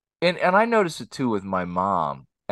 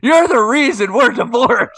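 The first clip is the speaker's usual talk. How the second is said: high, loud mocking accusation; mock dramatic mom; 10 dB louder than usual. Son mocking mom blaming him, high and loud. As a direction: throw the blame in a high mock voice. Mimicking mom's dramatic line.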